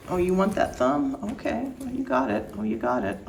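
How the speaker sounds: soft puppy talk. Real, not acted. Talking to the biting puppy.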